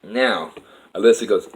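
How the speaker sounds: ordinary talk, nothing marked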